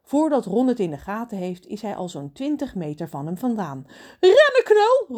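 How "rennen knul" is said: very high, loud shout; Trudy calling out. Acted. Mermaid shouting at Ron to run, high and loud. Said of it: "roept Trudy".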